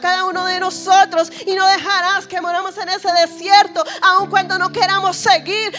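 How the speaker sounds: high, loud impassioned praying over music; fervent prayer. Acted. Preacher leading congregation in prayer for the hopeless, fervent loud prayer. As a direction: pray aloud with full force, pleading.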